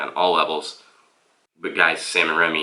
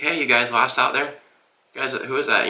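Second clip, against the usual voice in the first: put-on raised, drawling Southern old-timer calling out from a bush; 7 semitones higher than usual. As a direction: call out like a friendly Southern old man hidden in the brush. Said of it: "a voice, like a southern, you know, voice".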